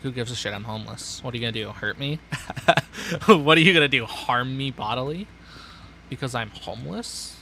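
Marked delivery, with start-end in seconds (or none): 3.3-5.3 louder cocky challenge; playful loud provocation; mock taunt. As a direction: dare them to try. Taunting as a homeless man.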